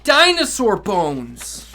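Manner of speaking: ordinary delivery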